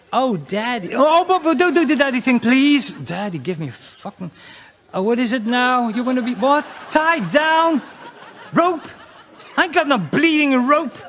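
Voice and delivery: high, loud mock pleading; hammed-up bedroom quarrel. Comic voicing a bedroom quarrel with a woman, high and loud. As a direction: ham up both sides of the row.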